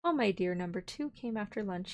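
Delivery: plain reading voice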